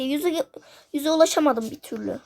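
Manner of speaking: normal talking voice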